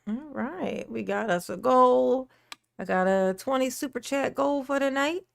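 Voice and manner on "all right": usual speaking voice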